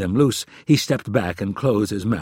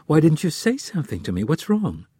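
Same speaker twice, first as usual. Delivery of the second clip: fast, higher, anxious questions; worried, rushed; 6 semitones higher, 4 dB louder than usual. Acted. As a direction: push the questions out in a rush. Anna worried, pressing.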